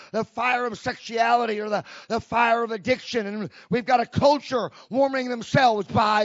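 loud, punchy sermon delivery with call and response; preaching at full force. Acted. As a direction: preach it hard to the congregation. Preacher exhorting the church.

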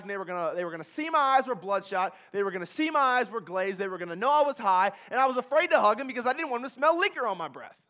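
loud, driving testimony climax; preaching at full force. Real, not acted. Speaker telling the crowd of fear of facing his parents, loud preaching. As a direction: preach it to the back row.

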